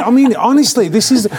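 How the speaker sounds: ordinary talk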